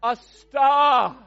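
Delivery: "A staff" drawn out loud, broad a; savouring the broad a in "staff"; savoured accent. Shown as a demonstration. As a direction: relish the long a in "staff". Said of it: "Music to my ears".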